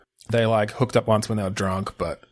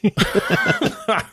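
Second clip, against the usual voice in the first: laughing; 12 semitones higher than usual. Real, not acted. Laughing at Neighbours name.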